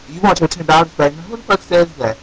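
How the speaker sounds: ordinary delivery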